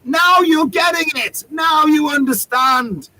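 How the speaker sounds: high, slow, drawn-out mock triumphant shout. Performer shouting back at his heckler, mock triumph. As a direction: stretch each word, crying out in retold triumph. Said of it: "I'm shouting".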